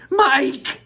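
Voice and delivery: high, loud cry of his name; desperate scream. Acted. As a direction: scream for her lost son. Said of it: "screamed Missus T V".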